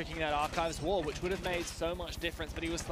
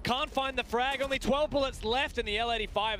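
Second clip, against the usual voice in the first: higher, louder live commentary; hyped play-by-play; 7 semitones higher, 4 dB louder than usual. Real, not acted. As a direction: build the tension of the clutch.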